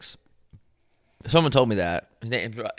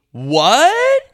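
high, loud rising "what?"; incredulous outburst, pitch shooting up; loud disbelief; 8 dB louder than usual. Real, not acted. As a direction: squawk it in shock. Reacts to the lyric.

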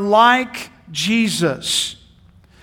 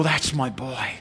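tender father; 6 semitones lower, 6 dB quieter than usual. Retold or imitated. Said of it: "I want Dad to go".

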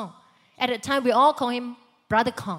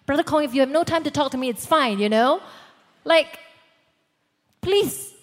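fast, louder mock-indignant rush; offended inner rant; 5 semitones higher, 7 dB louder than usual. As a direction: retell the huff, faster and louder. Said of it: "I'm thinking".

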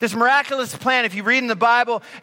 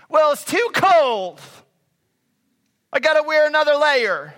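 complaining.

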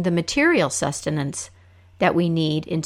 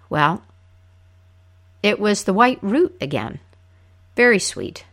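normal talking voice

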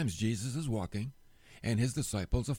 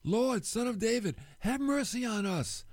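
mildly raised, higher-pitched plea; crying out; 8 semitones higher than usual. Retold or imitated. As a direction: call out a plea without full shouting. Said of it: "The guys cry out".

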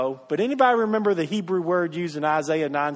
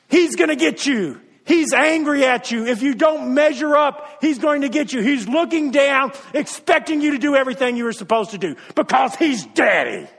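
louder, higher, accusing preacher voice; stern judge; 5 semitones higher, 4 dB louder than usual. Acted. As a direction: paint God as a threatening judge. Picturing an angry judge God.

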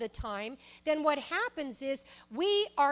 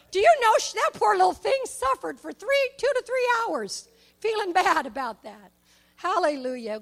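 much higher and louder fervent preaching; preaching at full force; 6 semitones higher, 10 dB louder than usual. Acted. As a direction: swell up in wonder and exhort the crowd. Passionate preaching about the lady.

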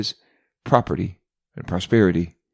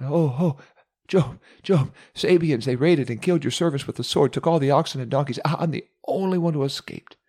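high, frantic cries of a servant out of breath; breathless panicked servant; 5 semitones higher than usual. Acted. The servant who ran to Job cries out the bad news in a panic. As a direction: gasp it out like you ran all the way. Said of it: "running toward him and out of breath, crying out".

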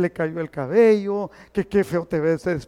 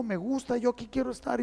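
much softer, gentle; soft, quiet words; 3 semitones higher, 8 dB quieter than usual. Retold or imitated. Old father quietly telling the pastor he likes it here. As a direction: say it quietly and content. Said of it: "me dijo".

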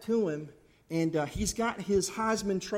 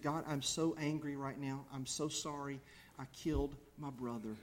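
soft confession; 5 semitones lower, 11 dB quieter than usual. Pretend. Imagined confession of Cain.

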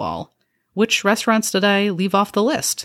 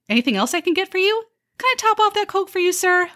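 high, chirpy server voice with a snide edge; a fake-cheerful waitress needling her customers; perky sarcastic waitress; 6 semitones higher than usual. A waitress sweetly insulting her diners, chirpy and high.

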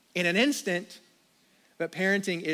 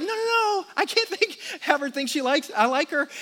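much higher, frantic pleading voice; panicked teenage son; 8 semitones higher than usual. Retold or imitated. Son panicking as dad likes a girl's photo, high frantic. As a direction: beg him to stop, panicking. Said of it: "and he's like".

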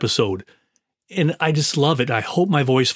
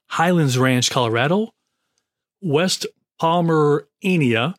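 normal talking voice

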